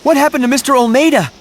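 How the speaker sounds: high, loud and fast, shouting in alarm; alarmed outcry. Acted. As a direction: blurt it out in shock, high and quick. Alarmed cry over master's fate.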